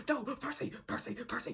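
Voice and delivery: high, urgent mock plea; urgent hushed call. Captain calling Percy back.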